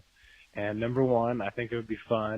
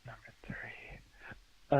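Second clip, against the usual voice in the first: whisper; 8 dB quieter, much slower than usual. Real, not acted. Voicemail, whispered aside.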